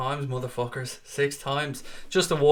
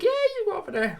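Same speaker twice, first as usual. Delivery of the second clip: high, mock imitation of the wife. Quoting the wife who is glad to see him go, in a mock high voice. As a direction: squeak it out as the happy wife. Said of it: "That she's like".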